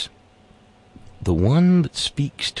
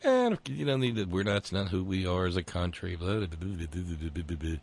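dismissive parody; 2 semitones lower, 9 dB quieter than usual. Retold or imitated. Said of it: "Lindsay is in high dudgeon".

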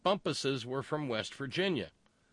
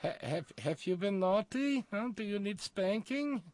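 mock sultry European voice; 10 semitones higher than usual.

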